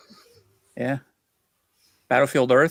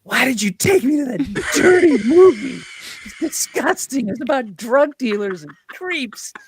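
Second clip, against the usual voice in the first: high, slow, prim voice of a disgusted date; mock disgusted wife; 11 semitones higher, 5 dB louder, slower than usual. Driving home, the date scolds him for the dirty movie, in a high, slow, prissy voice. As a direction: imitate her prissy outrage, drawn out. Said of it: "and she's like".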